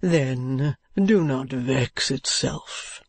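slow, whispery soothing; Mr. Mantalini. Acted. Husband soothing his pouting wife, slow whispery coaxing. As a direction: soothe in a drawn-out, airy whisper.

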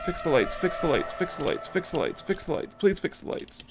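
fading to a scared breathy plea; frightened pleading. Real, not acted. Begs repeatedly in dark.

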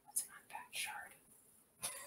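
whispered with lips barely moving; hushed aside through still lips; ventriloquist voice. Real, not acted. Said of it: "in my ventriloquist voice".

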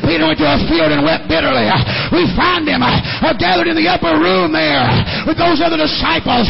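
impassioned preaching. Acted. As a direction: preach it with tears near the surface. Peter and Mary story preached.

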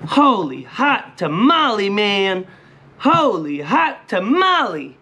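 slow, high, swooping amazement; drawn-out thrilled disbelief; amazed exclamation. Real, not acted. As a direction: stretch each word out, stunned by the win. Stunned by the big win.

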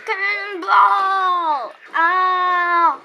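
loud, slow drawn-out yell; cannonball yell. Real, not acted. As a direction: bellow it while launching. Launching bird in the game.

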